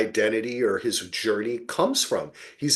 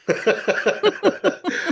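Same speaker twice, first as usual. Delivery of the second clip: high breathy laugh. Real, not acted. Laughing at the absurd.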